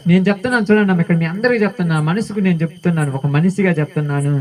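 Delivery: usual speaking voice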